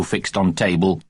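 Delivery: ordinary delivery, nothing marked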